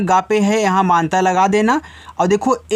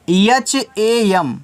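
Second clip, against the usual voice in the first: letter by letter; much slower than usual. Shown as a demonstration. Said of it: "लिखा है हम".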